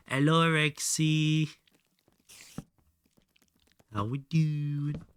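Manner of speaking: high, slow, sing-song hello; a slow, lifted hello to a viewer; drawn-out greeting. Real, not acted. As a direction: stretch the greeting out warmly and high.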